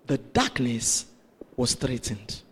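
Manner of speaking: slow, low, with a pause after darkness; weighty repetition. Real, not acted. Preacher repeating the point to congregation, slow weighty delivery. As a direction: repeat it gravely, phrase by phrase.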